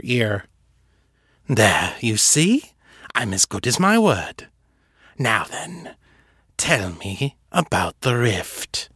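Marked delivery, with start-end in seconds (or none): 1.5-8.9 slow, hushed villain at her ear; intimate menace. Acted. Fisher kneeling at Seffi's ear, hushed menace. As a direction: murmur it close and cold.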